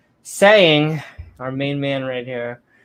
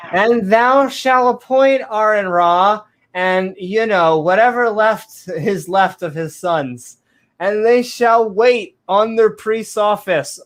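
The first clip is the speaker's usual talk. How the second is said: 1 mock scripture; 5 semitones higher, 4 dB louder, slower than usual.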